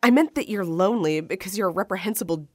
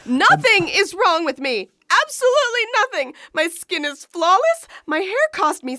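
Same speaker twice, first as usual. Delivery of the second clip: high, rising indignant protest; indignant outburst; 9 semitones higher, 3 dB louder than usual. Acted. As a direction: protest far too much. Defensive outburst over looks.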